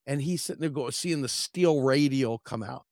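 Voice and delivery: normal talking voice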